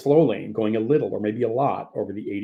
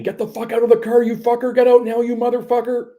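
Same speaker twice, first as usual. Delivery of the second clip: furious scream, much higher and louder; 12 semitones higher, 6 dB louder than usual. Acted. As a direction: scream it through the window in blind rage.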